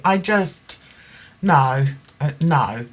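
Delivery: ordinary talk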